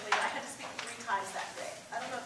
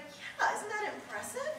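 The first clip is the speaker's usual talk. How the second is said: smug naive student; 12 semitones higher, 4 dB louder than usual. Retold or imitated. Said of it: "And I was like".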